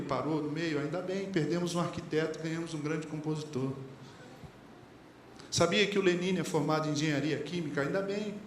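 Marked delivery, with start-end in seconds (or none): none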